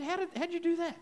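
very high, soft, incredulous voice; imitated amazement. Acts out healed man's amazement.

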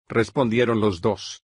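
ordinary delivery, nothing marked

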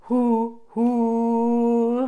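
put-on an old train's long, steady hoot; grandpa train whistle. Shown as a demonstration. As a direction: hoot in a normal speaking register. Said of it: "How about a grandpa train whistle?".

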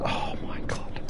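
a whispered oh my God after a driving blunder; dismayed whisper. Real, not acted. To himself after botching the bus, whispered dismay. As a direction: breathe it out under your breath in dismay.

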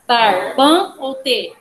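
"Bar", "ban", "T" said slowly, each syllable apart. Shown as a demonstration. Teacher asks class for the strongest syllable, syllable by syllable. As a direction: split the word into slow, separate syllables. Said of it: "qual que é a sílaba mais forte".

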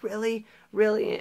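high-pitched imitation of a surprised girl; surprised date. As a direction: squeak in mock disbelief. Imitating date's surprised reply.

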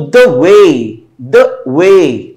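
light, short "the", long weighty "way"; stress on WAY. Shown as a demonstration. Teacher sounding out an iamb, "the" light, "way" stressed. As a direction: skim over "the" and stretch "way". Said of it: "ऐसे बोला गया".

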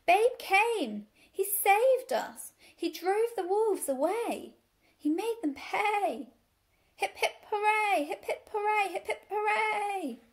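high, loud, drawn-out bleating cheers; hymn of praise. A narrator's reading. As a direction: cheer like a jubilant flock of sheep. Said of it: "nothing less than a hymn of praise".

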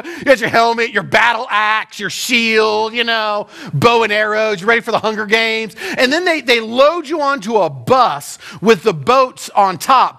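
ordinary talk, nothing marked

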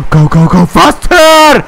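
high, loud laughing shriek; shrieking excited yell. Real, not acted. Urging car in race.

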